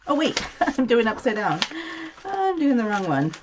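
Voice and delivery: oops, caught off guard, half laughing; surprised laugh. Real, not acted. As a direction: catch your own mistake and laugh at it. Noticing her crafting mistake.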